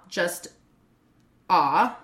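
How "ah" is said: "ah" as one pure open vowel; lone, lower-pitched "ah" set apart as an example; vowel demo. Shown as a demonstration. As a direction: isolate a single "ah" sound, no glide. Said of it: "O sounds like gone or ma".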